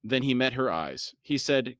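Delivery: usual speaking voice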